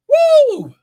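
sudden high whoop of joy; ecstatic high woo. Real, not acted. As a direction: let out a joyful shriek of praise. Preacher's ecstatic outburst.

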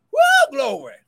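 high, loud whooping praise; joyful church whoop; ecstatic praise shout. Real, not acted. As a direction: let the glory burst out.

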